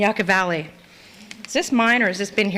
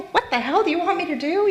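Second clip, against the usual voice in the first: exasperated; 7 semitones higher, faster than usual. Retold or imitated. Mocking cop's demands.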